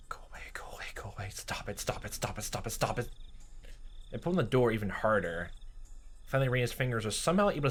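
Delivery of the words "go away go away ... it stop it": whispered, growing from much softer; terrified whisper. Acted. Keiichi muttering to himself in fear, whispered. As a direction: mutter it in terror.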